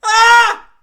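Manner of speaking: high, very loud scream; sudden yell. Pretend. Host fooling around before the break, sudden loud scream. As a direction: let out one abrupt yell.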